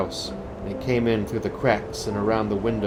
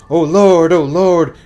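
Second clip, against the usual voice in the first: much higher, much louder panicked prayer; terrified moan; 8 semitones higher, 12 dB louder, slower than usual. A narrator's reading. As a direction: cry it out, shaking with fear. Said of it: "shivered and shook and moaned".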